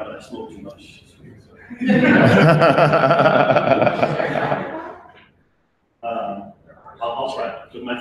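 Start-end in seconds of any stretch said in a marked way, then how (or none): 1.2-5.1 hearty burst of laughter; loud laugh. Real, not acted. Laughs at own smoking joke.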